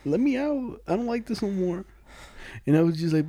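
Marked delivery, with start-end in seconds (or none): 0.1-1.9 mock pleading. Imitating Danny begging to leave the car, high plea. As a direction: beg in a raised little voice.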